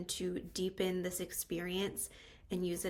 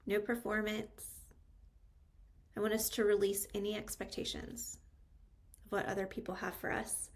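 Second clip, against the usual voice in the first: unhurried meditation guide, long pauses between phrases; calm, spacious meditation delivery with lingering pauses; guided meditation; 3 dB quieter than usual. Real, not acted. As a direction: guide them into stillness, phrase by phrase.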